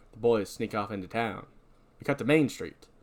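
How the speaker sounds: normal talking voice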